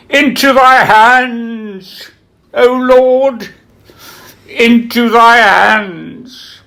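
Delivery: high, slow and ringing with uncanny firmness; dying Becket's final words. Retold or imitated. Irving as the dying Becket, speaking his last words to the Lord in a slow, high, ringing voice. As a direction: intone it as a dying actor's last line, loud and unwavering. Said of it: "which rang out with uncanny firmness".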